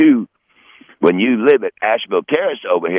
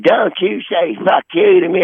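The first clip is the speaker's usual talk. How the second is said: scolding aunt; 7 semitones higher than usual. Retold or imitated. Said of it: "She said".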